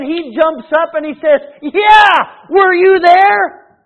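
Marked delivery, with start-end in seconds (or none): 1.6-3.8 slow, loud, high mock surprise; feigned surprise. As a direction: play innocent, drawn out. Beggar caught, feigning surprise.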